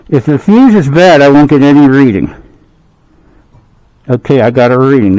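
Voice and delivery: talking around a phone in the mouth; phone held in teeth. Real, not acted. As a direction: speak with a phone clenched in the teeth. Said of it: "I'm holding my phone with my mouth".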